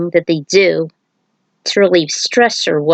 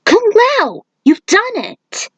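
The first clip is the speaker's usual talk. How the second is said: high, slower voice praising him; Nightwolf cheering; 12 semitones higher than usual. Acted. Nightwolf cheers Kung Lao.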